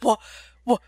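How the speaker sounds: hammed-up shock over lost hockey; mock disbelief. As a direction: act devastated at each canceled sport. Derek learning hockey is canceled.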